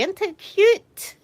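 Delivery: high, drawn-out coo. Real, not acted. Streamer showing viewers a cuddly blueberry toy, cooing. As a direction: coo adoringly over something cute.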